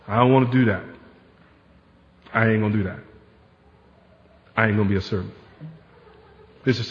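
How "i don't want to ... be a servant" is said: low, understated digging in of heels; reluctant refusal. Acted. Preacher voices a member refusing to volunteer, reluctant and low. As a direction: refuse quietly and stubbornly, dropping low.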